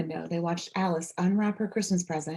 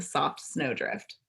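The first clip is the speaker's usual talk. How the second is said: usual speaking voice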